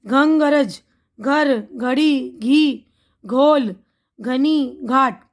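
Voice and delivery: each word drawn out slowly; slow and careful. Shown as a demonstration. Teacher spelling out words for children, slow and careful. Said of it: "अब देखिए घ का लफ्ज़ है".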